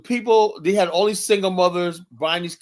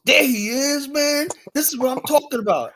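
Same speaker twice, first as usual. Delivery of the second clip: high, eager greeting; mock hyped-up stranger; 10 semitones higher than usual. Stranger hailing him at the store, high and overfamiliar. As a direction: greet him like an old buddy, pitched high. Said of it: "this guy goes".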